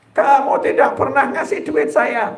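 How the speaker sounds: lightly voiced nagging wife; imitating angry wife. Preacher mimics a wife complaining about money. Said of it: "kalau istri marah".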